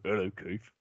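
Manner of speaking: low, soft chuckling boxer voice; chuckle, then a low, quiet hello; lightly voiced imitation of a laughing boxer; Frank Bruno. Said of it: "and he'd just go".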